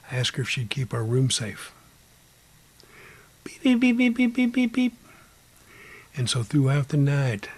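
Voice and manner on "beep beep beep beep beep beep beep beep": rapid high, loud beeps; string of electronic-style beeps on one high pitch; imitated meter beeping. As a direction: answer as a beeping machine. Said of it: "she would beep yes".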